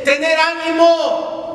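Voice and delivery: high, loud, slow shout of courage; commanding call. Acted. Jesus shouting encouragement to his frightened disciples. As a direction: call out over the wind. Jesus calling over the storm.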